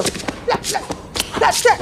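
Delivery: light, high, voiceless slapping noises; flapping sole demonstration. Retold or imitated. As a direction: mimic shoe soles slapping out a beat. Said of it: "like this right here".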